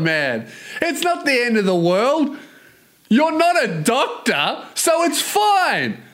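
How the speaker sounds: high-pitched, incredulous mock pleading; exasperated reply. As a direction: tell a bro off in a high, exasperated voice. Imagined exasperated reply to excuse.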